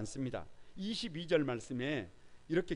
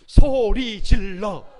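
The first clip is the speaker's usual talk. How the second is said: full-voice very slow shout, higher and much louder; 7 semitones higher, 20 dB louder, much slower than usual. Shown as a demonstration. Pastor and congregation shouting the line together, loud and slow. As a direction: bellow it out with the congregation. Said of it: "다 같이 한번 해보세요. 시작".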